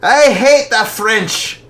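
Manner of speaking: high, loud, slow declaration of hate; angry outburst. Acted. Father ranting about the French, loud and slow. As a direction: spit out the hatred.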